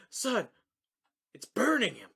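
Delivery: high-pitched mock sheriff voice. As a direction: put on a comically high sheriff. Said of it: "the sheriff's like".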